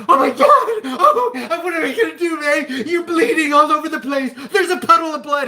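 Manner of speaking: high, loud, frantic freak-out over the blood; hammed-up panic, voice shooting up; mock panic. Husband showing how not to react to a bleeding wife, loud and high mock panic. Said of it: "and I'm freaking her out".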